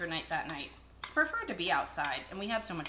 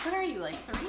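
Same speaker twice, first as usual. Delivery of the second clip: teasing; 8 semitones higher, 5 dB louder than usual. Real, not acted. Teasing him about the fork.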